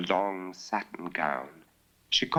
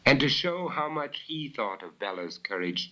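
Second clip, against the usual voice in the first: ordinary delivery, nothing marked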